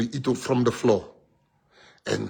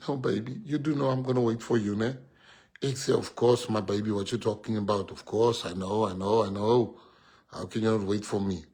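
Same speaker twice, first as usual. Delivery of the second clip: soft tender retold talk; 5 semitones lower, 6 dB quieter than usual. Retelling girlfriend and his replies.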